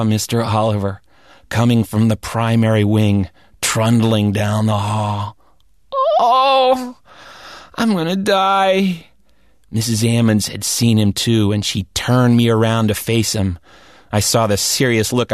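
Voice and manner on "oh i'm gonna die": high wail, then slow groan; boy dreading the vice principal; mock dread. Boy sees vice principal coming.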